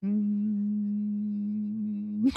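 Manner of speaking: soft closed-mouth hum like an electric motor; quiet car hum. Shown as a demonstration. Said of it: "it sound like this".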